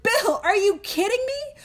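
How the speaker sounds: high, incredulous scolding; mock outrage at her husband; exasperated wife. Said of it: "Lois, his wife, was like".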